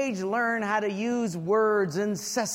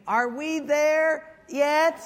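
put-on whiny kid; 5 semitones higher, 3 dB louder, much slower than usual. Child asks in back seat.

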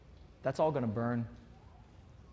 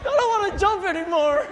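much higher, loud, whiny; whiny complaint; 14 semitones higher, 8 dB louder than usual. Retold or imitated. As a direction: whine like a worn-out kid. Whining exhausted.

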